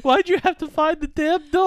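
high, put-on exasperated parent; the joke parents grumbling that the dog found her; mock parent. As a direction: complain to the girl as a fed-up parent.

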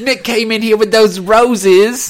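loud, high hammed-up Australian accent; stretched-out, over-the-top Australian bellow; Australian croc hunter. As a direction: shout it like an Aussie wildlife showman. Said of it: "What is she, the croc hunter?".

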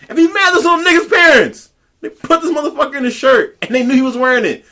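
heated rant at the parents; angry rant. Real, not acted. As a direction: climb into outrage.

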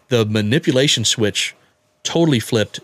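ordinary delivery

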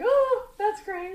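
put-on intimidated kid. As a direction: squeak it out, too shy to look at him. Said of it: "I was so intimidated".

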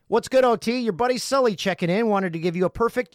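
loud high hyped greeting; excited. Acted. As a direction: greet like a buddy.

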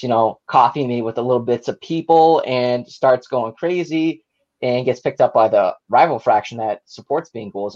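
ordinary delivery

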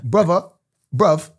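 practising London slang in accent; put-on London accent; London accent attempt. Shown as a demonstration. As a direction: try the words in a London accent. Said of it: "Is that how they talk in London?".

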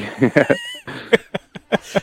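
a long, high-pitched cackle; delighted squealing laugh; high burst of laughter. Real, not acted. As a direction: laugh off the teasing. Guest teased about nightclub past.

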